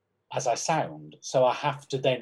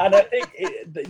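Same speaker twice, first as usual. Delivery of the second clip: laughing; 7 semitones higher, 9 dB louder than usual. Real, not acted. Laughing after attempt.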